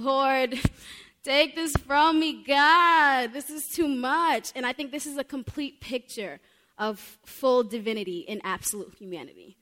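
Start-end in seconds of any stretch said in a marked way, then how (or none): 0.0-4.5 louder, higher, half-laughing plea; raised anguished plea with a laugh; pleading flesh. Acted. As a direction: beg as the weak flesh would. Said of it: "his flesh is saying".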